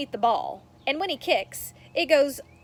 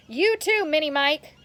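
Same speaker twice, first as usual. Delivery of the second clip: higher, louder jeer; yelling; 5 semitones higher, 4 dB louder than usual. Acted. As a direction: shout the taunt from the field. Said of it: "Ricky yells from the field".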